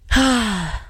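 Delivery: short deflated sigh; resigned sigh. Real, not acted. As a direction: sigh and let it go. Resigned after the story.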